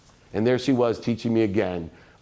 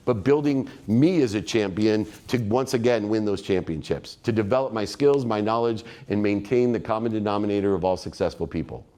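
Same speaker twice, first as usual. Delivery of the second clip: ordinary talk, nothing marked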